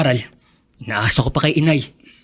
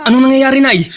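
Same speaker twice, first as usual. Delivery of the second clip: higher, louder worried question; alarmed; 8 semitones higher, 6 dB louder than usual. Acted. Son hears mother cry out, asks in alarm. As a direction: rush to ask what happened.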